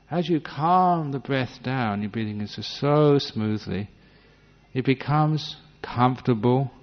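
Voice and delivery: slow, drawn-out, soothing words; slow right down to show a calm breath. Shown as a demonstration. Teacher slowly showing students a calmed breath. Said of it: "This is all what we mean by calming it down".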